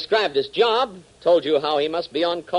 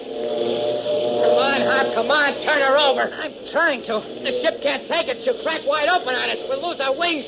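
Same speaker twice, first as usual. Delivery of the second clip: higher, straining voices fighting the plane; urgent cockpit panic; 8 semitones higher than usual. Acted. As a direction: shout over the storm in a struggling cockpit. Pilots struggling in storm.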